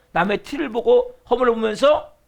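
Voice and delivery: usual speaking voice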